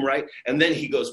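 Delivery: usual speaking voice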